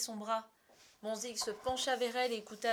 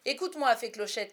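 loud, higher, furious summons; angry shout; 3 semitones higher, 12 dB louder than usual. A narrator's reading. Peter rounds on the fairy, angry shouting. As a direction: yell at her to listen. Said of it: "cria-t-il".

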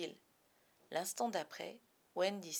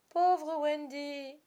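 slow, high drawn-out pity; 7 semitones higher, 5 dB louder, much slower than usual. A narrator's reading. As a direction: stretch it out with pity. Pity for moaning bird.

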